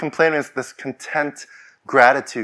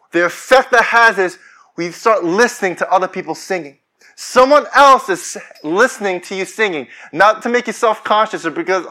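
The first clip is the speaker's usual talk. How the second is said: ordinary delivery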